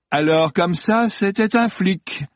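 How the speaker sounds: high, drawn-out sneering amusement; amused mockery. Actor learning the phoney producer was police, mocking.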